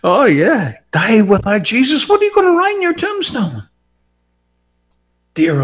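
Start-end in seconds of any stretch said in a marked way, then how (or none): 0.9-3.7 mocking challenge.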